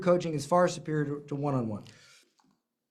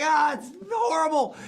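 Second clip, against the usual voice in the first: mock despair; 8 semitones higher than usual. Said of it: "And I'm like".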